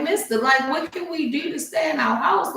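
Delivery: high, anxious plea to the owner; worried pleading. Retold or imitated. Pleading with the house owner, a high anxious plea. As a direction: beg the man to let you stay. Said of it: "I'm all worried".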